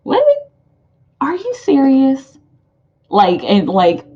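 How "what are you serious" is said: surprised disbelief. Retold or imitated. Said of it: "it might come as a surprise".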